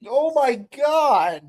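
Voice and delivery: real high-pitched, drawn-out dismay; appalled exclamation. Real, not acted. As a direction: wail it in comic horror at the discovery. Dismayed at own search history.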